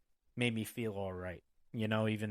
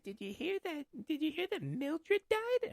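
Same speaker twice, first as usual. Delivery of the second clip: gossipy mom. Retold or imitated. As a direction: mimic mom eagerly spreading news, pitched way up. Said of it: "she'd be like".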